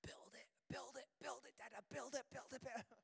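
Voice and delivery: imitated small child's excited whisper; hushed, insistent little-kid whisper. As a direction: whisper it urgently, like a pleading toddler. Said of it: "he's kinda whispering".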